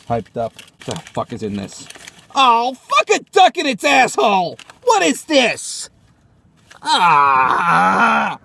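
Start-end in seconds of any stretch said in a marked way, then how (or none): 2.3-5.9 high-pitched angry cursing; exasperated outburst. Acted. Opening a messy package, exasperated. 6.8-8.4 long drawn-out cry of frustration; frustrated yell. Acted. Man faced with spilled crumbs, frustrated cry.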